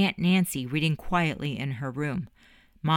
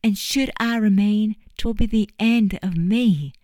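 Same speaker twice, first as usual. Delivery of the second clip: high, louder, hammed-up mock tragedy; mocking dramatics; 6 semitones higher, 6 dB louder than usual. Juliet mocking Eddie's dramatic plea, hammed-up and louder. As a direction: throw his melodrama back at him. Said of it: "Eddie and his dramatics".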